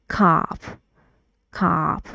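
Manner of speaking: cop with a short open vowel; British model of cop; model word cop. Shown as a demonstration. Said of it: "We say, like".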